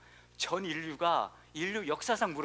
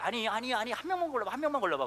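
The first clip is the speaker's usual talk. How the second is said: insistent wife; 7 semitones higher than usual. Retold or imitated. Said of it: "제 아내가 이렇게 얘기해요".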